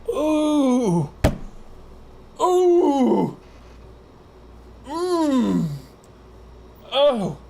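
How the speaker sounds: very high, drawn-out "ooh" and "mm"; stank-face moans. Acted. Friend hearing the band on a shared earbud, high moans of pleasure. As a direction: moan as the groove hits you. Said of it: "they'll be like".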